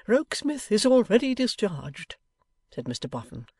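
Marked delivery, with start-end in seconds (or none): 0.1-2.2 muffled behind his hands. A narrator's reading. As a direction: speak through covered face. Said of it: "speaking in a muffled voice".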